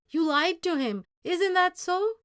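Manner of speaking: loud, high reproach; angry. Acted. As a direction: rebuke the boy sharply. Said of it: "His Spanish was fast and angry".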